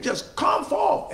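high, slow shouted call to the dead; shouted command. Retold or imitated. Preacher shouting the bare command to the grave. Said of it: "if he just would have said".

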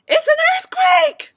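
very high, loud shriek; panicked shout. A narrator's reading. Mother thinks an earthquake has struck, high panicked shout. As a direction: shriek it in alarm. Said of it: "shouted Missus Watson".

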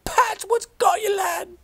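high loud shout into the fog; frantic call. Retold or imitated. Shepherd reliving calling his lost dog, shout. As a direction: yell for the dog in alarm. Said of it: "I cries".

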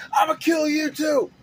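high, loud mock yell; retold threat. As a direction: shriek it at the newcomer. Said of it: "turns to the guest and goes".

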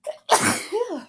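a sharp cough that breaks the sentence; cough, then apologize; real cough. Real, not acted. Sick vlogger coughing mid-review, real cough.

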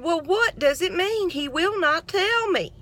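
high-pitched baffled plea, retold; high exasperated wondering; pleading high question. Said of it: "And I said".

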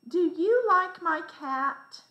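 "Do you like my cat?" high and loud on you; question intonation. Shown as a demonstration. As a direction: ask "Do you like my cat?" as a strongly lifted question. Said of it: "the second sentence says".

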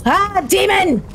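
high, sudden yelp of alarm; startled cry. Real, not acted. Startled by a game demon.

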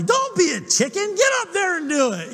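hammed-up goading shout from the riverbank; mock yell. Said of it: "she starts yelling at me".

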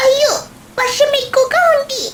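put-on high, bright-eyed little kid voice; child voice. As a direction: speak like an eager small child. Child worried about family. (చిన్న పిల్లాడి గొంతు)

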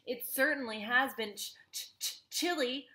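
high voice stuttering with cold; teeth chattering. Acted. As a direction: stammer the ch from shivering.

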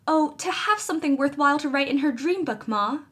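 high, tumbling, alarmed rush; incoherent excitement. Acted. Felicity confessing to her mother, excited and alarmed. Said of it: "said Felicity incoherently".

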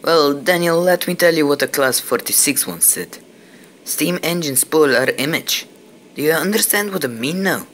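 a hint of a Scottish brogue; strong Scottish accent. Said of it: "The newcomer spoke with a strong Scottish accent".

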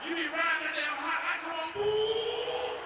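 very high, loud, stretched-out squeal; drawn-out thrilled holler; giddy excited cry. Acted. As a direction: squeal with glee at the hot sign. Excited at hot donut sign.

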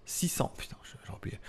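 breathy whispered curse to himself; whispered aside. Real, not acted. Tech presenter whispering to himself after fumbling the numbers. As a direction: drop to a whisper, annoyed at the slip.

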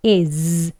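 is said with a buzzing, drawn-out z at the end. Shown as a demonstration. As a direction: let the end of is vibrate as zzz. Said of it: "이렇게 발음하지 않아요". (is 끝을 즈 하고 울려서)

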